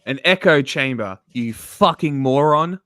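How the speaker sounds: real angry outburst at a pundit; angry shout. Real, not acted. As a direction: bark insults at the screen. Yelling at Tim on screen.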